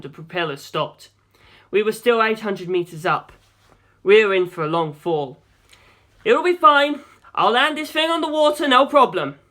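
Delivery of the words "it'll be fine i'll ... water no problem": loud, high bravado shout. A narrator's reading. Pilot shouting reassurance to navigator before crash, loud. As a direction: shout confidence you don't feel. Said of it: "I shouted".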